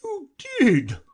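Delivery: a weepy creature snapping; high loud contradiction; sharp retort. Acted. Mock Turtle contradicts.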